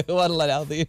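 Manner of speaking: real laughter breaking through the words; laughing. Real, not acted. Host laughing at a listener's old neighbourhood game. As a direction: laugh it off, giggling between phrases.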